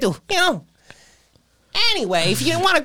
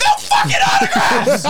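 very high, screeching yell; flip-out shout. Acted. Doorman shrieking at autograph seekers, high and furious. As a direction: lose it completely and shriek. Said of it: "I always flip out, and my accent goes away".

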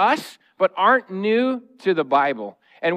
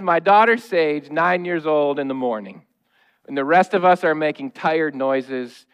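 ordinary delivery

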